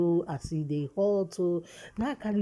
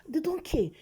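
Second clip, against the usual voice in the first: exasperated outcry; 11 semitones higher than usual. Real, not acted. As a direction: cry it out in frustration. Ranting about police.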